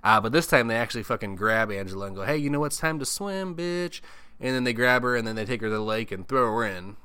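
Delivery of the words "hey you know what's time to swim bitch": high, mocking taunt; taunting bully. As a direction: sneer it at the victim. Mean girls grab Angela.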